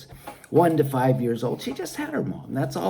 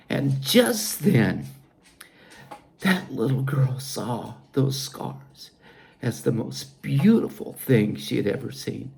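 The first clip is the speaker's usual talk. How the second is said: normal talking voice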